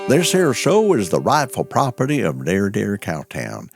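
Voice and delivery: usual speaking voice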